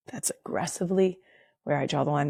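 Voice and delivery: fast whisper on aggressively, then softly; whispered emphasis. Shown as a demonstration. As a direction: breathe it fast and low.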